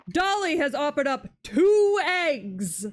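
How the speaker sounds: loud proud cheer with TWO stressed; excited announcement. Real, not acted. Player cheers the chicken Dolly laying two eggs, loud proud announcement. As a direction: proclaim it like big news.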